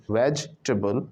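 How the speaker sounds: वेजिटेबल run together as vej-tuh-bul; joined-up pronunciation. Shown as a demonstration. Teacher shows वेजिटेबल joined up, vej-tuh-bul. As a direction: say वेजिटेबल as one word, middle vowel dropped. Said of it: "एक साथ बोलना".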